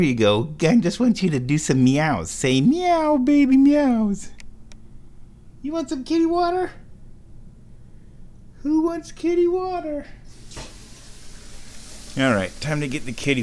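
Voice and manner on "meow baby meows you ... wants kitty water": very high, sing-song coaxing. Real, not acted. Owner coaxing her cat to meow in high baby talk.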